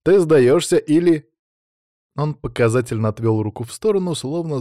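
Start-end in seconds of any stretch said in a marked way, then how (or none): 0.0-1.3 loud, high, slow taunt; taunting demand. A narrator's reading. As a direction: draw out the challenge, louder and higher. Victor taunts beaten duel opponent.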